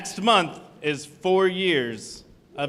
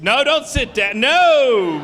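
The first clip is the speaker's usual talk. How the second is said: calling out; 7 semitones higher, 7 dB louder, slower than usual. Real, not acted. Host stops audience sitting down.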